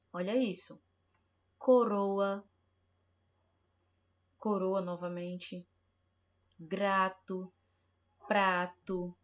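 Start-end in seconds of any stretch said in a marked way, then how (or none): 1.6-2.4 Coroa with a light tapped R; soft R. Shown as a demonstration. Teacher contrasting the soft R, crisply. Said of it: "o R com som brando". 8.3-9.2 Prato with a quick tapped R; soft R. Shown as a demonstration. Teacher reading a soft R example, clearly. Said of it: "o R com som brando".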